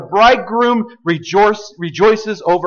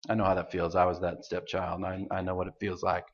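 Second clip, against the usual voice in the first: much softer, low personal admission; quiet confession; 13 semitones lower, 14 dB quieter, faster than usual. Real, not acted. Preacher admitting he was the stepchild, softly. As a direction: drop to a hushed confession.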